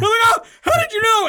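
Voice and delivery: high, loud panicked wail; caught-out shriek. Retold or imitated. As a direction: shriek like you've just been caught.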